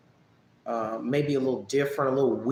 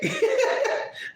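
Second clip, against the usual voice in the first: amused laugh. Real, not acted. Laughing at own emoji.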